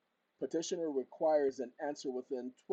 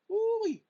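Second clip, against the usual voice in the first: delighted high squeal of ooh-wee; 8 dB louder than usual. Real, not acted. Pleased with the caption layout, gleeful high squeal. Delighted exclamation while formatting.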